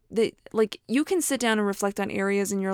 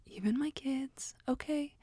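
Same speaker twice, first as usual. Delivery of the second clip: whispering; 4 semitones higher, 9 dB quieter than usual. Real, not acted. Aside whispered about kids.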